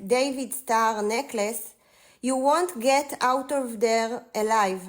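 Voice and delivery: ordinary talk, nothing marked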